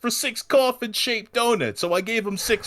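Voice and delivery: high-pitched put-on character voice; vignette witness impression. Host quoting the donut shop witness, put-on high voice. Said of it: "vignettes of the people in the Undertaker sightings".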